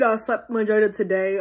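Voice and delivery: ordinary talk, nothing marked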